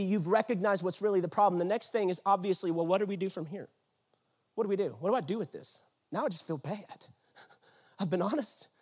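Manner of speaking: usual speaking voice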